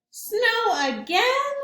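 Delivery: high, slow dismayed cry of snow again. Acted. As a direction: let the disappointment stretch out.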